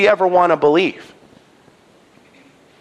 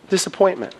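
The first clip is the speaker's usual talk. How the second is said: ordinary delivery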